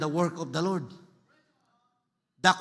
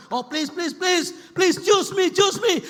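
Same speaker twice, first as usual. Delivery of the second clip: high, insistent begging, building louder; urgent pleading; 9 semitones higher, 5 dB louder than usual. Acted. Preacher begs to be chosen, high urgent plea. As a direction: plead harder with each repeat.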